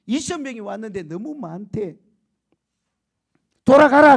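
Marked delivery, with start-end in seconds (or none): 3.6-4.2 loud, high divine order to go home; booming command. Retold or imitated. Preacher booming God's order to leave. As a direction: send them away with a big voice. God dismissing the army.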